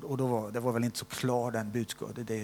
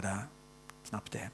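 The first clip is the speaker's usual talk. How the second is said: normal talking voice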